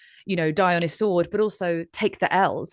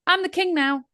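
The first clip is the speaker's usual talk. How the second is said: high, smug mock crowing; cocky young lion; 12 semitones higher, 3 dB louder than usual. Young lion gloating to King Lion Usyk, high mock brag. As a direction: crow it at the old king like a brash upstart. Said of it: "walks up to King Lion Usyk and goes".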